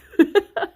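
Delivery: a high, loud laugh that rises; high burst of laughter. Real, not acted. Laughing at positive covid result.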